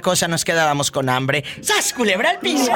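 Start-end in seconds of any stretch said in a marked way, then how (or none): 1.6-2.7 high, loud playful shout; shouted catchphrase. Acted. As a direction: yell it out like a show catchphrase. (grito de muletilla)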